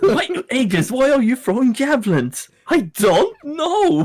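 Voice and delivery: high-pitched, incredulous questioning; high comic confusion, stretched at the end; mock bewilderment. Acting out baffled companions' exchange.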